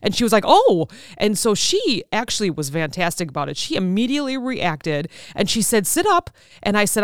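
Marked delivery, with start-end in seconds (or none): 0.5-0.9 startled oh. Retold or imitated. Hygienist reacts to sudden panic. 5.9-6.3 urgent command. Retold or imitated. Hygienist urgently tells her to sit.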